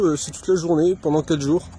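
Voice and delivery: ordinary talk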